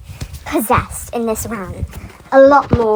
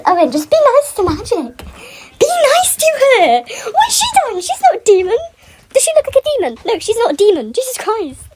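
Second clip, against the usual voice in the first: laughing outburst; 10 semitones higher than usual. Real, not acted. Fan scolding Owen about Magic, higher laughing shout. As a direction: yell at him through laughter.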